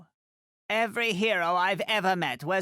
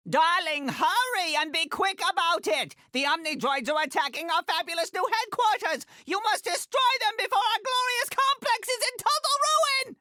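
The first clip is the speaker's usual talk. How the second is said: much higher, shrill-pitched alarm rising to a peak; frantic urging; 13 semitones higher than usual. Acted. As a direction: panic over the fabulous headquarters. Headquarters under attack, urging hero.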